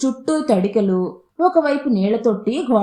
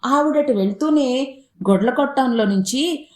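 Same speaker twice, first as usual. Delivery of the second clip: plain reading voice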